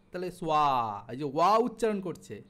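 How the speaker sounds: ordinary talk, nothing marked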